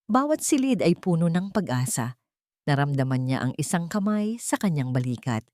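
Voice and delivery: ordinary delivery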